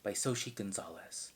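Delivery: slow, measured mock audiobook read; deliberate, overly careful narration; audiobook narrator voice. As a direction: parody a commercial fiction narrator, pausing after each phrase. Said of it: "audiobook narrator voice is always like".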